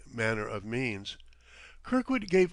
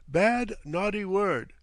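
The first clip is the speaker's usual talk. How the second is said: slow, higher, wagging-finger reproof; mock scolding; 8 semitones higher, 4 dB louder, slower than usual. A narrator's reading. Captain teasingly reproving his guest, slowly. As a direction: tut at him like a nanny. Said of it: "wagging a reproving forefinger".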